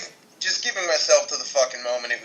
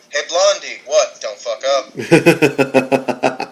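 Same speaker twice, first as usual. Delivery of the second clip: very slow, louder quote with a laugh breaking through; quoting a web series; 5 dB louder, much slower than usual. Retold or imitated. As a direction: drawl it out like the character's parting jab. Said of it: "and go".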